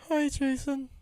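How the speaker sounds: a frozen, high-pitched hello; squeaky terrified greeting. Retold or imitated. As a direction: greet the killer in shock. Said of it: "And I'm just like".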